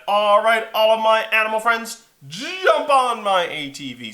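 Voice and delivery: loud, high rallying call. Acted. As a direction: holler it to the whole gang. Liam calls animals aboard.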